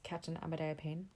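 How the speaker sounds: ordinary delivery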